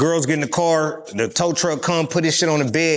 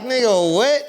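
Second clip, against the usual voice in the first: confused locals; 9 semitones higher, slower than usual. Retold or imitated. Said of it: "They like".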